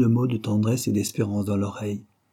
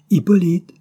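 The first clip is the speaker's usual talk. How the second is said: high, loud call by name; 9 semitones higher, 9 dB louder than usual. A narrator's reading. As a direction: call out for someone missing. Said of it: "Et il appelait".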